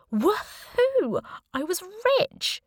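high-pitched triumphant cheer; gleeful whoop. A narrator's reading. Heroine discovering she has money, gleeful whoop. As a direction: crow with delight, voice shooting up high. Narrator celebrates sudden money.

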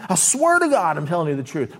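a lightly hammed-up liar insisting; mock swearing oath. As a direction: liar swearing to God, a touch overdone. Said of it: "put at the end of it".

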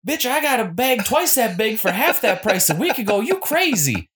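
high, sassy Southern woman; sassy lady voice. Retold or imitated. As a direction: go up a little and a little south. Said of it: "That's my sassy lady voices".

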